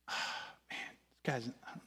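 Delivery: very soft, sighing aside; doubtful muttering. Retold or imitated. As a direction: mutter your misgivings to yourself. His doubtful aside during the call.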